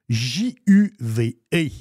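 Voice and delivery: letter by letter, with gaps; spelled out. Shown as a demonstration. Host giving listeners the winery's name, spelled out. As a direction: spell it out, each letter on its own. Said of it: "je vais l'appeler".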